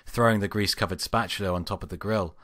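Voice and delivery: ordinary delivery, nothing marked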